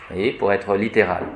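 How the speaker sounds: ordinary talk, nothing marked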